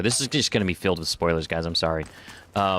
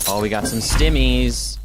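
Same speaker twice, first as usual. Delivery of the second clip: louder, slower delighted find; excited loot cheer; 3 semitones higher, 7 dB louder, slower than usual. Real, not acted. Gamer cheers finding stimmies in game. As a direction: savour the loot like a prize.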